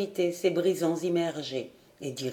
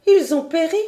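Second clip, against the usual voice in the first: high, loud, dismayed question; alarmed question; 9 semitones higher, 7 dB louder than usual. A narrator's reading. Lady Helena asks John about the lost sailors, alarmed. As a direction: ask it in alarm, pitch leaping up.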